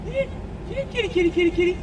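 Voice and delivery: very high singsong kitty call; calling a cat. Real, not acted.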